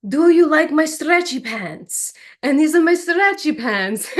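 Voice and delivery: high, loud goofy Mexican wrestler voice; Nacho Libre voice. Retold or imitated.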